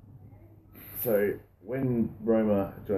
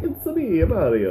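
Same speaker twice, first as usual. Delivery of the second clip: put-on Italian accent; 10 semitones higher, 4 dB louder, slower than usual. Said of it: "as racist as saying".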